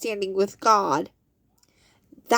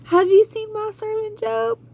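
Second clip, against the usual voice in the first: high proud voice of God showing off; God bragging; 11 semitones higher than usual. Acted. God bragging to Satan about Job, high and proud. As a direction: boast like God to Satan, pitched way up.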